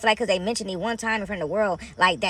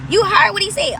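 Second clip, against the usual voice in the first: high, loud exclamation, caught up in faith; excited outcry; 12 semitones higher, 8 dB louder than usual. Real, not acted. To her viewers about Jesus, a loud high excited outcry. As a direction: let it burst out high and loud.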